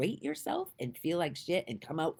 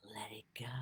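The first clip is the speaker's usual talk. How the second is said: a hushed whisper, much softer; 6 semitones lower, 16 dB quieter, faster than usual. Real, not acted. Urging viewers to release their guilt, soft whisper. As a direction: let it out as a breath.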